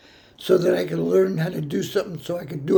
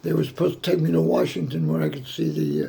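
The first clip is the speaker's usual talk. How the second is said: ordinary talk, nothing marked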